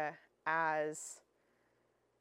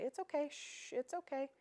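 higher, soft cooing, shushing; hushing soothing owner; 8 semitones higher, 4 dB quieter than usual. Retold or imitated. Owner at the vet hushing a panicking dog, soft and cooing. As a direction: hush a panicking dog tenderly. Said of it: "you'll even hear owners say".

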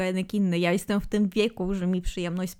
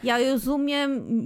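usual speaking voice

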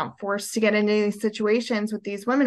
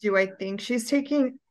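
ordinary talk, nothing marked

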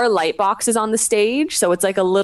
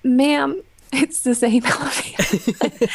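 stifling a laugh; 6 semitones higher, slower than usual. Retold or imitated. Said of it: "I was like".

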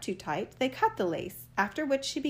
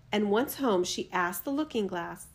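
plain reading voice

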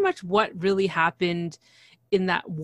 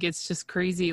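ordinary delivery

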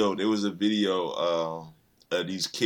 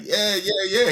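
put-on excited kids; 12 semitones higher, 7 dB louder than usual. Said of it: "He was like".

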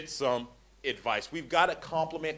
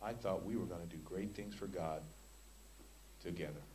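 quiet quoted plea; 10 semitones lower, 18 dB quieter than usual. Retold or imitated. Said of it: "she said these words".

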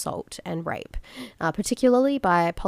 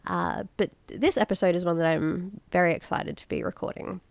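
usual speaking voice

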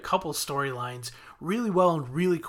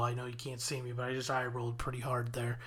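hushed, conspiratorial whisper; whispered aside; 3 semitones lower, 8 dB quieter than usual. Real, not acted. Podcaster confesses eye roll to listeners, hushed whisper. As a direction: lean in and confess it under breath. Aside about rolling eyes.

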